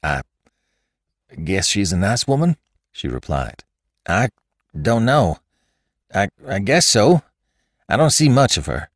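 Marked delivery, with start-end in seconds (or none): 0.0-2.6 halting, choked start; strained, broken-up answer; choking voice. A narrator's reading. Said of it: "replied in a choking voice". 4.1-8.9 halting, higher-pitched evasion; uneasy, broken-up reply; flustered stammer. A narrator's reading.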